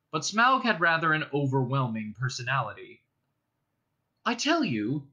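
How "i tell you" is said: Bilbo protesting. Acted. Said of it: "in an effort to remain loyal".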